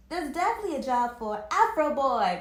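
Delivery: loud proud declaration; boastful loud claim; declared. A narrator's reading. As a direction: announce it loudly. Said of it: "declared Anthony".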